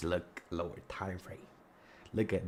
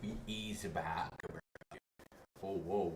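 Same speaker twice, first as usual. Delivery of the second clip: ordinary talk, nothing marked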